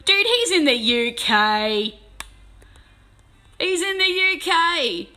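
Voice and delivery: slow, loud, drawn-out correction; exasperated correction. Real, not acted. Correcting a chatter about the UK, loud and slow. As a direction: spell it out to the chat, loud and slow.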